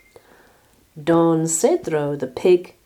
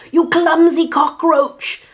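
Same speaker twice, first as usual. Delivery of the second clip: high, loud, slow screech; furious lizard; 11 semitones higher, 7 dB louder than usual. A narrator's reading. Lizard scolds Martina for spilling, furious screech. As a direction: screech each word in outrage. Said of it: "hissed Don Lagarto".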